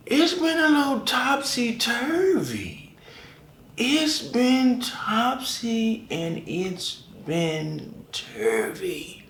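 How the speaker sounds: high, very slow sing-song; drawn-out high sing-song joke; sing-song topsy-turvy. Acted. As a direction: stretch it out like a playful tune.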